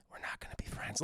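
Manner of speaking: menacing whisper. Retold or imitated. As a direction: breathe it out cold and secret.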